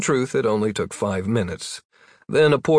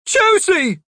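alarmed high shout of a name; 6 dB louder than usual. A narrator's reading. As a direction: call out in alarm. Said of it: "The woman shouted".

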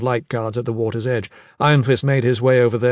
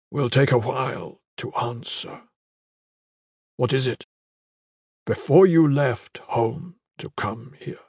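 slow, weak and tender; dying warrior. Acted. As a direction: ask gently while fading. Dying brother asks softly.

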